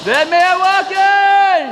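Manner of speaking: slow drawn-out shout; man down shout. Real, not acted. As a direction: shout it out slowly and high. Player just hit, calling out.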